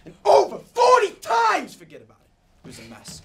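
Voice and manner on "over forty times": sudden pained shout on the count; yell. Acted. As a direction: bursts out, reliving the mess. Recounting the ice-pick killing.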